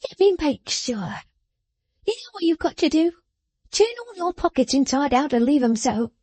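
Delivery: high, sharp scolding voice; scolding little woman. A narrator's reading. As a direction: scold him like a child. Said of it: "cried the person of the house".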